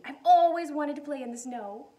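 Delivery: loud eager outburst; excited. Acted. Corduroy dreams of playing in snow, loud and excited. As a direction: burst out with joy. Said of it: "he said excitedly".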